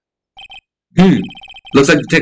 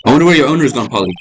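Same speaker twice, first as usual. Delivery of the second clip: ordinary talk, nothing marked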